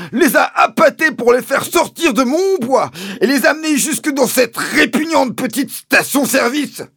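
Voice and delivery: shrill, very loud tirade; Mister Hazel crying out. A narrator's reading. Landowner accusing the father of luring pheasants, high angry shouting. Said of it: "s'est écrié mister Hazel".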